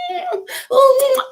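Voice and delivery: very high drawn-out mock yowl; imitated yowl. [name] picking up the clawing cat, high mock yowl.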